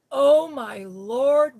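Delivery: slow, loud, high Oh my Lord; stretched-out shocked cry; loud exclamation. Retold or imitated. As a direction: shout it out in shock. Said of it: "really loud on the, on the phone".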